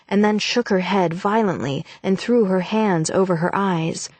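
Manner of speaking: usual speaking voice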